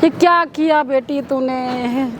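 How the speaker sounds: low, slow, stricken reproach; anguished lament. Acted. Mother to pregnant daughter, slow heartbroken lament. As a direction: drag it out low, as a crushed parent.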